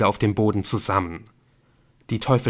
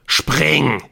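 loud, higher bellowed order; roaring; 5 semitones higher, 6 dB louder than usual. A narrator's reading. Helen roars at Ortolan to jump, furious. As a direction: bellow the command. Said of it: "brüllte sie".

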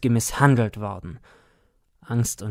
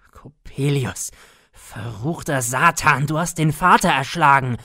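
building to a loud, higher furious scream; screamed accusation; 3 semitones higher, 5 dB louder than usual. A narrator's reading. Son over his dead father, furious rising scream. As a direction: hurl the curse, rising to a scream. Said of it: "So schrie ich auf".

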